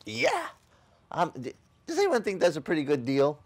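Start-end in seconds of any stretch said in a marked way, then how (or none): 0.1-0.5 excited yeah. Retold or imitated. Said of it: "I was like".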